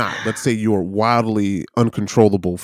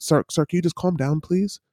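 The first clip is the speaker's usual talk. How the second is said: higher, softer, condescending plea; condescending store clerk; 6 semitones higher, 6 dB quieter than usual. Acted. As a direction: placate an upset customer from above. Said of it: "if you're at a store and you're upset".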